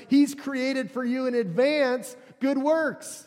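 drawn-out, forceful preaching delivery; emphatic proclamation. Acted. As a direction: declare it to the whole congregation, leaning on each phrase. Preacher proclaims Paul's words to congregation.

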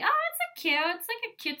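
squeaky-high mock cooing; girlish "aww, cute" in a very high voice; high-pitched gushing. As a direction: coo over something cute, way up high. Acts out gushing over cute bra.

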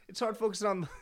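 breaking into laughter; laughing, barely holding it; laughing harder. Real, not acted. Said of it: "hard to play these card games".

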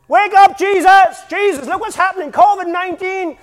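loud, high panicked shout; mock desperate cry. Frantic believers begging Jesus to wake up, loud and high.

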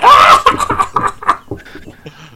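high, loud hysterical laughing; mock kid cackle. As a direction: imitate a child screaming with laughter. Imitates kids cackling at joke.

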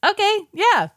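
chirpy crowd yes; high eager agreement. Retold or imitated. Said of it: "they're all like".

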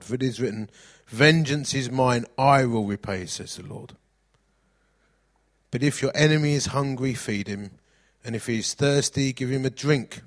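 rising, emphatic verse reading; reading Romans to the church with force; scripture reading. Acted. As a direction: preach the verses out. Reads scripture aloud.

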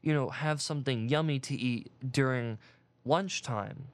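ordinary delivery

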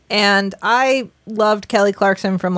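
ordinary talk, nothing marked

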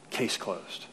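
whispered, with finality; very soft, whispered pronouncement; hushed verdict. Acted. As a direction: drop to a whisper to seal the verdict.

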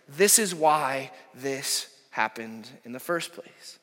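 slow, weighty and deliberate; slow emphatic. Acted. Pastor driving home the point, slow and weighty. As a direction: lay each word down slowly.

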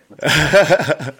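laughing out the words. Real, not acted. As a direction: say it through a laugh. Guest laughs, keen to start.

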